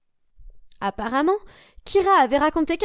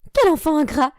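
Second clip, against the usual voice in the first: put-on louder, indignant scolding of an ungrateful child; indignant mother; 4 semitones higher, 5 dB louder than usual. Mother scolding her daughter for refusing, outraged.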